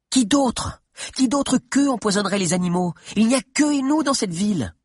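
high, indignant questioning; heated insistence. Acted. Adviser pressing the chief about the poisoners, heated. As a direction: push the point, voice pitched right up. Mordelia argues heatedly back.

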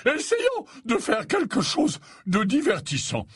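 high-pitched, theatrically merry professor; joyful Slughorn. Acted. Slughorn sets three pupils a fun task, joyful high voice. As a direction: announce a treat with relish, high. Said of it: "l'air joyeux".

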